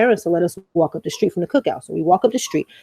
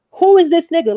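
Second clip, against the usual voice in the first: indignant yell; 10 semitones higher, 5 dB louder than usual. Retold or imitated. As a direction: yell it out, outraged. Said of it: "Like, I literally said".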